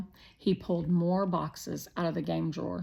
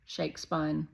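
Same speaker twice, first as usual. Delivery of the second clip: usual speaking voice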